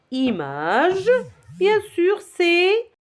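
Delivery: ordinary talk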